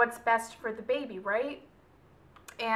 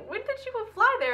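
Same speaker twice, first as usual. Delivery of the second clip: much higher, acted surprise; surprised question; 10 semitones higher than usual. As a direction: pitch the question right up in disbelief. Acts out her surprised question.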